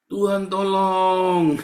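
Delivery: loud, high, drawn-out plea; mock cry for help. Preacher imitating a desperate believer, crying out long and loud. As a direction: stretch it into a hammed-up wail to heaven.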